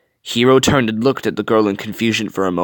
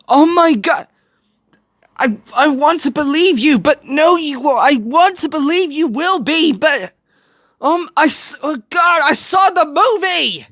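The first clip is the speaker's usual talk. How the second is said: much higher, stammering distress; anguished dread; 14 semitones higher than usual. Real, not acted. Viewer who saw the movie dreads Tadashi's fate, high anguished. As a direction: fall apart knowing how it ends.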